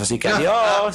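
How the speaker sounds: high, sing-out goodbye; breezy farewell. Acted. Egoism leaving the others on the island, breezy. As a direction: toss off a carefree goodbye.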